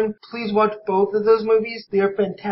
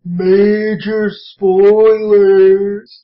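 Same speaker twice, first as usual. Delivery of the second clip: slow, stretched whale-speak; 6 dB louder, much slower than usual. Pretend. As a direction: drag the vowels like Dory speaking whale. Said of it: "sound like I'm doing my whale voice".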